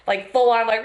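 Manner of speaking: normal talking voice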